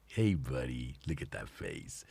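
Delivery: soft, low cooing at a pet. Real, not acted. Owner softly cooing to his cat Jackson, baby talk. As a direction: coo gently to the cat.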